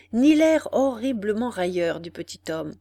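plain reading voice

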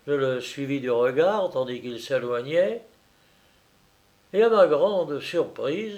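ordinary delivery

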